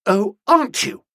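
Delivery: high, loud, challenging retort; crying out. A narrator's reading. Young woman answers his refusal, crying out. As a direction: fling it back as a challenge. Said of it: "cried Nobby".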